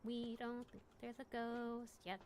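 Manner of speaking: slow repeat, a little softened at five under; slow, deliberate. Real, not acted. Storyteller repeating the disclaimer to listeners, slow, soft and deliberate. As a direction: repeat it slowly and softly for emphasis.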